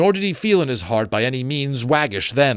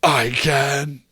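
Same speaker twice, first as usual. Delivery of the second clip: high, very slow, eerie ghost; slow ghostly voice; 5 semitones higher, 3 dB louder than usual. Acted. Marley's ghost challenging Scrooge, slow and eerie. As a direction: draw it out from beyond the grave.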